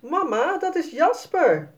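hoog, luid roepend kind; roepen. A narrator's reading. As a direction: roep het opgewonden als klein meisje. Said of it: "Sanne kijkt en roept". (kind roept enthousiast naar mama)

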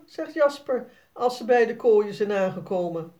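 ordinary delivery, nothing marked

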